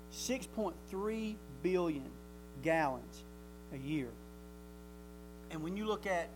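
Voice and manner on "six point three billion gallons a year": slow emphatic figures. Acted.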